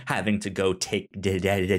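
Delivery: usual speaking voice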